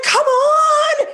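high, loud pleading squeal; desperate hopeful plea. Retold or imitated. Teen girl silently begging her crush to ask her, high excited squeal. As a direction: beg it like a smitten teen.

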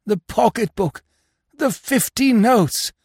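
high, slow, stricken; despairing. A narrator's reading. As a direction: stammer out the loss. Robbed husband discovers loss.

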